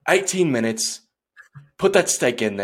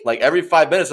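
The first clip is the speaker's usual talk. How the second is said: normal talking voice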